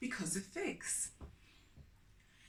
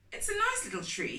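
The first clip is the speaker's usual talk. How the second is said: father's voice; 12 semitones higher, 4 dB louder, faster than usual. A narrator's reading. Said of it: "he said".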